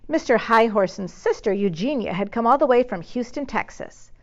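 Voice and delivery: ordinary delivery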